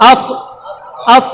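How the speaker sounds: "আত" with a bounce on the final heavy t; qalqalah bounce. Shown as a demonstration. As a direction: let the last consonant of "আত" drop and rebound. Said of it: "শেষে একটা ওই বলে drop খায়". ("আত"-এর শেষে কলকলার drop)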